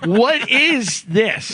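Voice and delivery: drawn-out, slow retold question; baffled disbelief. Retelling his reaction to coworker's threat, baffled disbelief. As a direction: stretch each word in disbelief. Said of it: "I'm like".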